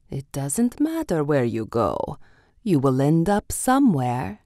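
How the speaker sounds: lightly voiced sly cat, teasing; smiling Cheshire Cat. A narrator's reading. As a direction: purr the riddle with a grin. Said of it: "smiled at her and said".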